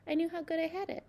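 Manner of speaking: plain reading voice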